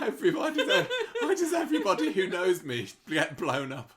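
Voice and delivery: high, loud mock wail through laughter; laughing mock Alia. Imagining Alia moaning everyone gets blown up, high, loud, laughing. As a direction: ham up the cursed victim while laughing. Mocking Alia's self-pity, laughing.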